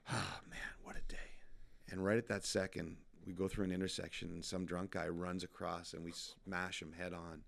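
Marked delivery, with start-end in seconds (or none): 0.1-1.2 breathy, spent sigh of relief; acted end-of-a-long-day sigh; relieved exhale. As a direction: let the words out on a tired exhale.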